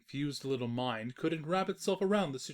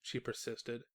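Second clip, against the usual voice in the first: ordinary delivery, nothing marked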